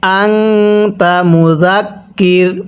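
slow chant, আংতা with a long nasal hum before "ta"; drawn-out আংতা, then a stop on মুজাক্কির; ghunnah recitation. Shown as a demonstration. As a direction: hold the ng of আংতা in the nose. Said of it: "গুন্নাহ করে পড়তে হয়".

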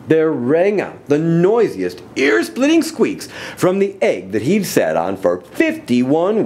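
animated, rhythmic storybook reading; story narration. A narrator's reading. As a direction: read the rhyme to children with big swings.

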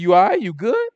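pushy and high-pitched; a little aggressive. Retold or imitated. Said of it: "I probably came at him a little aggressive".

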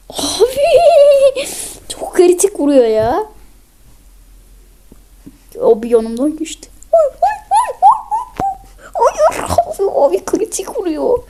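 very high, loud, laughing-crying squeals; high wailing panic; panicked shriek. Real, not acted. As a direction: shriek helplessly as the crits land. Shrieking as he gets crit.

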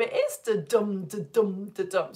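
steady da DUM rhythm, weight on each DUM; iambic beat. Shown as a demonstration. Teacher showing a class the beat, rhythmic. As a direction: tap out the beat with "dum" stressed.

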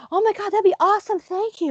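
imitating a high, squealy, thrilled fan; gushing fan. Fans thanking Liz, high and squealing with delight. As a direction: gush like a starstruck fan being promised a meeting.

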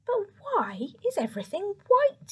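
high, curious robin voice; little bird voice. Acted. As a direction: ask it like a tiny bird. Robin asks Mouse.

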